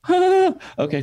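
very high startled squeak on "Ah"; flustered surprise. Retold or imitated. Starstruck fan handed back his pen, high squeak. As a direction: jump in pitch, caught off guard.

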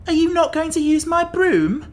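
very high, loud character voice; exclaiming Pumpkinhead. A narrator's reading. Jack protesting about his broom, high exclamation. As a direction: squeak it out loudly, held up high.

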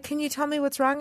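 soft, caring mom, not pushing; gentle asking. Shown as a demonstration. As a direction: ask softly, like easing a hurt child to talk. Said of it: "I was just being like".

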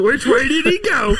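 high, loud, slow cartoon voice; drawn-out goofy cartoon line. Cartoon quote.

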